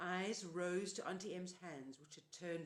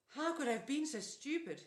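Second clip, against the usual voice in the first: high, louder exasperated self-blame; self-reproach; 8 semitones higher, 6 dB louder than usual. A narrator's reading. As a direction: kick yourself aloud.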